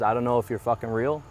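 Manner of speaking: ordinary talk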